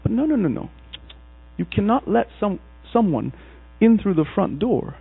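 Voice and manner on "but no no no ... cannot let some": ordinary talk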